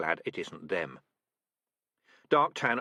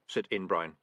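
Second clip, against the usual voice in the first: ordinary delivery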